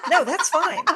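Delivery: high, laughing reply. Real, not acted. Guest laughing at being told there is no space.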